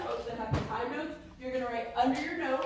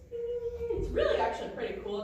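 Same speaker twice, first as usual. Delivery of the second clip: normal talking voice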